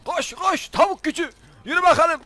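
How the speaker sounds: rally the chicken like a coach; egging on. Pretend. Gamer urges his chicken to run, high chant.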